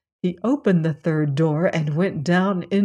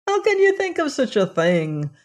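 lad crying out high in alarm; protest, voice jumping up in fright; cried out; 8 semitones higher than usual. Acted. Lad denying to his master, high frightened protest. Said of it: "cried the lad".

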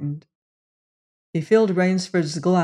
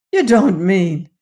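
louder, alarmed exclamation; crying out; 2 semitones higher, 7 dB louder than usual. A narrator's reading. Rainsford realising what the general intends, crying out. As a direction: burst out as the meaning dawns. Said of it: "cried Rainsford".

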